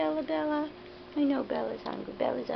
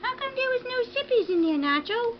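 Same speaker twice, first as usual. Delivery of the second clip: voicing the dogs; 10 semitones higher than usual. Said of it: "They're like".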